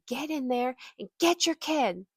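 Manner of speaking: high-pitched, imitated yelled order; mock yelling. Woman hurrying a mother along, mock yelling. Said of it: "she starts yelling at me again".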